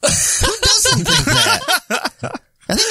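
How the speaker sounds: laughing. Real, not acted. Laughing reply.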